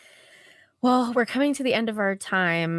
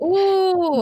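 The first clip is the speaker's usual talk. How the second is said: high delighted laugh; 12 semitones higher, 6 dB louder than usual. Real, not acted. Both laughing at compliment.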